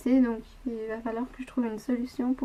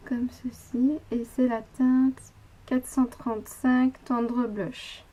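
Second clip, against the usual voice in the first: usual speaking voice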